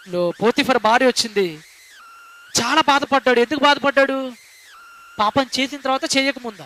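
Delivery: normal talking voice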